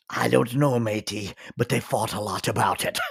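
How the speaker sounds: wife's hammed-up pirate voice; pirate impression. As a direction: growl it like a pirate captain. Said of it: "my wife's pirate impression".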